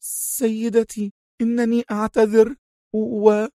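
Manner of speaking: high, slow, faltering apology; pale, apologetic nanny. Acted. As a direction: beg forgiveness, cut off mid-word. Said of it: "امتقع وجه المربية المسكينة".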